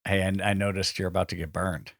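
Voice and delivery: quiet low mock Bruce Campbell; low, soft parody of the catchphrase. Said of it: "the famous catchphrase that Bruce Campbell says".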